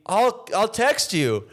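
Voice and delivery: high, slow insincere promise; awkward date goodbye. Retold or imitated. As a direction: fake a polite brush-off. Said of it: "You go".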